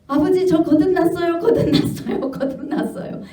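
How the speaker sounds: high, pleading cry of a wife, trailing into a laugh; mock tearful insistence that fades to a breathy laugh; pleading confession. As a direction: beg her Father to believe she is born again. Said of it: "계속 이런 고백을 했답니다".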